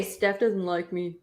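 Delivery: slow, low imitated sulk; mock self-pity. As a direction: drag it out softly, mimicking a moper. Mimics a complaint heard every day.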